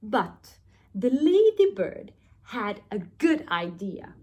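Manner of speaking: usual speaking voice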